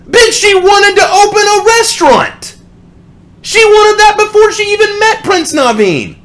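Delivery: very high, loud exasperated yelling; shrill-pitched angry outburst at the song; furious shout. Real, not acted. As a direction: scream it in outraged disbelief. Furious rant over Tiana lyric.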